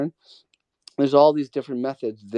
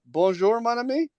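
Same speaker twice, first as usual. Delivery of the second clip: put-on French accent; 7 semitones higher than usual. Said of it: "I can tell my French accent".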